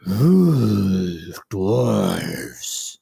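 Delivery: low, drawn-out troll relish. Acted. Troll turns round and finds dwarves, slow deep troll voice. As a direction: stretch each word into a deep troll rumble. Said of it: "The troll's head jerks around. He says".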